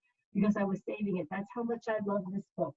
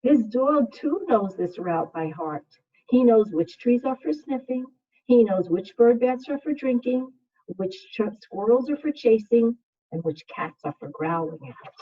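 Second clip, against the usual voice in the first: put-on reading to children; 7 dB louder than usual. Second grade teacher reading a picture book to her class, soothing.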